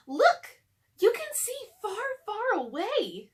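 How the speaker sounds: high, loud, eager call; a little girl thrilled by the view; excited child. Acted. Annie calls to Jack from the treehouse window, excited.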